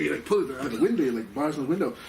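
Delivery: ordinary delivery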